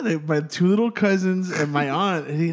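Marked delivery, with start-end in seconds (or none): none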